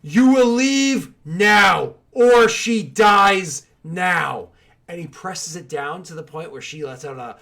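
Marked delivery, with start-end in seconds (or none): 0.0-4.5 very slow, loud, high threat; guard's drawn-out threatening shout; shouted ultimatum. Acted. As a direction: bellow each word as a deadly order. Guard threatens captive's companions.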